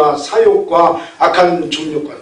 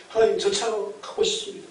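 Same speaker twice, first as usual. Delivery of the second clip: softly voiced plea to God; quiet imitation of someone praying; mock humble prayer; 12 dB quieter than usual. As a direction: act out a small, hushed wishful prayer. Acts out prayer wishing for car.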